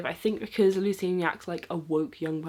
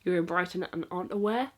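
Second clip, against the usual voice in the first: usual speaking voice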